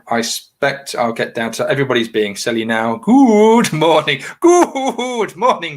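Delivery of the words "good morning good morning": very high, loud, drawn-out slow greeting; sing-song good morning. Pretend. Cheerfully greeting the livestream chat in a very high, slow sing-song. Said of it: "Everyone's loving the good mornings".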